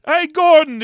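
brother's voice. Retold or imitated. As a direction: call out brightly to Gordon. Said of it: "the voice is just like".

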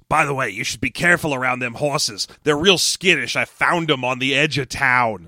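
a hint of a low, folksy impression; Danny DeVito. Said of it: "Danny DeVito goes".

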